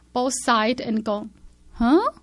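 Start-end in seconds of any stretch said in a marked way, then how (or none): 1.7-2.1 sudden loud startled huh, pitched up; a jumped-out-of-my-skin huh. Retold or imitated. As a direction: jolt out a shocked huh. Startled by the voice, looks around.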